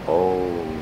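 ordinary delivery

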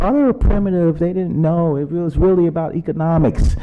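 voicing other people's condescending dismissal; dismissive mimicry. Mimicking people who dismiss past societies.

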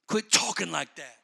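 usual speaking voice

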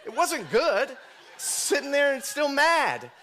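high, loud complaint with laughter; comic indignant protest. Retold or imitated. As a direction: protest loudly, half laughing. Comic protest about his upset.

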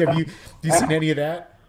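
normal talking voice

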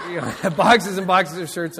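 slow, laughing through the words. Real, not acted. Pastor recalling boxes of unsold shirts, laughing. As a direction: chuckle over the pile of boxes.

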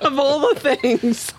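high, slow, laughing through words; amused and breaking up; helpless laughter. Real, not acted. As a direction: squeeze it out while cracking up. Laughing at her friend's nerves.

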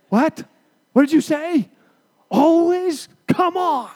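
high, loud feigned disbelief at a wrong verse; playfully aghast, pitch jumping up; mock shock. Preacher teasing the congregation over a misquoted verse, mock shocked.

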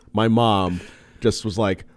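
ordinary talk

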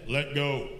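low, calm divine command; voice of God. Acted. The Lord tells the man on the branch, deep God voice. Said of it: "And the Lord said".

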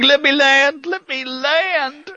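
high, loud plea on a plane; hammed-up panicked prayer, drawn out; mock praying. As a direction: beg for a landing in mock terror. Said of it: "just praying to Jesus".

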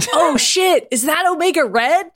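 high, giddy exclamation. A narrator's reading. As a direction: blurt it out, a little too giddy. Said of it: "a little too giddy".

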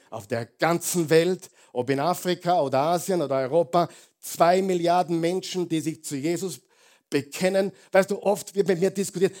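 loud, high preacher's climb; rising into a fervent, loud sermon peak; full-force preaching. Acted. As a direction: drive it home to the congregation. Preacher rallying about worldwide church.

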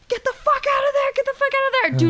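high-pitched, insistent retold urging; urgent warning. Telling a friend to flee the breakup, urgent.

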